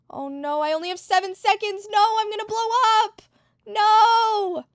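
high, loud frantic scream; panicked screaming. Real, not acted. About to explode, panicking.

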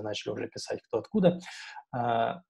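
normal talking voice

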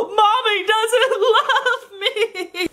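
put-on high, very slow crying child; whiny toddler voice. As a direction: wail like a snowman-obsessed kid. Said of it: "he'd go".

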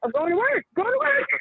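rising mockery. Real, not acted. Mocking going to work.

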